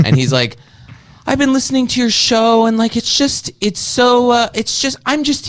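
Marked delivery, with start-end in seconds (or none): 1.3-5.5 high, whiny mock voice; mocking high voice of the host. Guest mocking the podcast host in a high whiny voice. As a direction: squeak it out like a prissy mocking parody. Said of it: "do I sound like that?".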